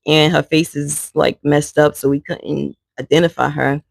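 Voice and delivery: ordinary talk, nothing marked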